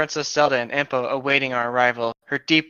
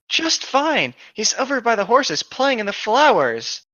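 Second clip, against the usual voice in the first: a higher, light fairy voice; 8 semitones higher than usual. Acted. Fairy telling Link about the baby, lightly voiced. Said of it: "she said".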